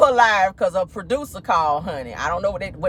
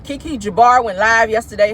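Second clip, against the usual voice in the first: ordinary talk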